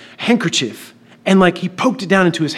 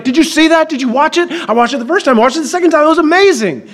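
high-pitched, breakneck excitement; freaking out; 8 semitones higher, faster than usual. Retold or imitated. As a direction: talk over yourself like amazed schoolkids. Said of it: "Everybody's freaking out".

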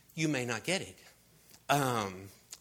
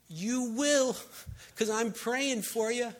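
put-on high, insistent child's voice; imitated little kid; 10 semitones higher, 3 dB louder, slower than usual. Kids imagined assuring someone they pray for, high childlike voice. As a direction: pitch it up like a confident praying kid. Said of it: "I could just hear these little kids saying".

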